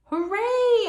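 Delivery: very high, loud joyful hooray; shouted cheer. Acted. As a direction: cheer it like popcorn is the best thing ever. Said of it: "I shouted".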